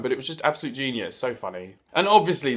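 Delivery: ordinary talk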